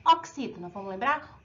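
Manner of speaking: usual speaking voice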